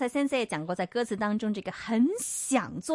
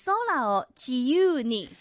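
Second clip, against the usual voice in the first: slow, careful echo of the teacher; slow learner repeat; much slower than usual. Shown as a demonstration. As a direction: repeat it slowly after the teacher. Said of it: "每个人跟先生学一次".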